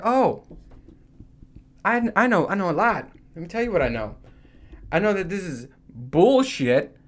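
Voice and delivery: huffy retort when asked what he knows; indignant. Retold or imitated. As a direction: puff up and tell them off.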